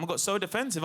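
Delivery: ordinary talk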